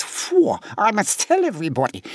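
a parrot thrilled by gossip. Acted. Parrot overhears the boy and squawks the news. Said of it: "the parrot who lives in the shoe shop hears this and thinks".

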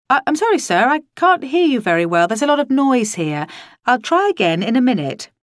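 high, loud apology down a bad line; pretending bad line. A narrator's reading. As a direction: call out as if you can't hear. Pretending she can't hear boss.

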